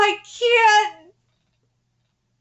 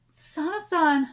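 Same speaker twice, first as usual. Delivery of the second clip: normal talking voice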